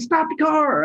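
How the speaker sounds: high-pitched imitated cry; urgent plea, imitated. Passenger begging driver to stop, imitated urgent cry. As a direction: mimic a passenger crying out in alarm.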